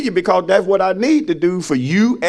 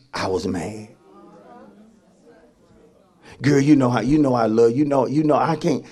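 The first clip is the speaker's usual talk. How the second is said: lower, softer begging excuse; mock pleading apology; 5 semitones lower, 4 dB quieter than usual. Apologizer begging a girlfriend to understand, lower and soft. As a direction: plead and wheedle, lightly mocked.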